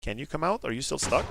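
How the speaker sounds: ordinary delivery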